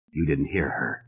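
a grim, quiet reply to himself. A narrator's reading. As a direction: say it low and grim. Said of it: "he said grimly".

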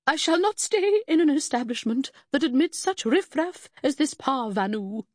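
high, loud, affronted grande dame; haughty lady. Acted. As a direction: sweep out in lofty outrage. Affronted fake lady storms out.

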